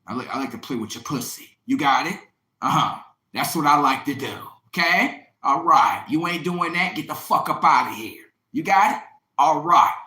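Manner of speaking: loud, cocky put-down; loud dismissal. Retold or imitated. Boyfriend dismissing girlfriend, loud and cocky. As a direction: shove her off with every word.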